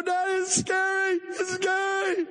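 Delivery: put-on child on his father's lap, crying out scared. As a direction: wail it like a terrified little kid.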